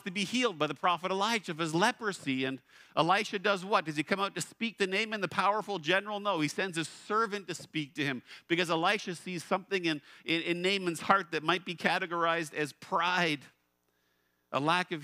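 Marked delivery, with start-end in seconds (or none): none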